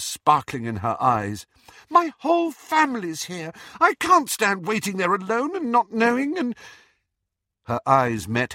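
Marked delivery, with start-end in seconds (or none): 1.9-6.6 shouting. A narrator's reading. Daughter shouting at her mother, high and tearful. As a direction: shout it through angry tears. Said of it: "Ginny shouted".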